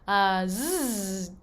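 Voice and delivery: slow steady voiced zzz like a bee; buzzing zzz. Shown as a demonstration. Coach showing the Z buzz to students, a steady bee buzz. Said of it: "pretending to be like a little bee".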